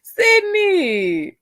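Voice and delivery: high-pitched, drawn-out cry; long, high wail of a name; exclaiming a name. Acted. Mock exclamation after laughing.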